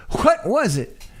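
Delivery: high Southern lawyer voice, puzzled; a baffled Southern uncle. Acted. The uncle voice asks again.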